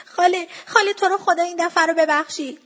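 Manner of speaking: child's high-pitched pleading, lightly acted; tearful plea. A narrator's reading. Child begging auntie to let it go, choked-up plea. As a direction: beg with a lump in the throat. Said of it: "بچه بغضش گرفته بود".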